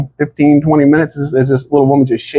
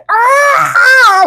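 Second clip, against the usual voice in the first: very high, drawn-out comic scream; mock manly yell; 4 dB louder than usual. Soldier hit by a karate chop, mock manly scream. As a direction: wail it out high, supposedly manly. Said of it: "make, like, a manly noise".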